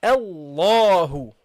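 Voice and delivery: Allahu stretched slowly with a full, heavy lam; slow heavy recitation. Shown as a demonstration. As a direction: draw out Allahu, thick and dark on the double l. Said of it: "then you go heavy".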